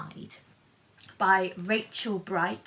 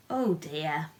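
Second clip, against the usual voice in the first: usual speaking voice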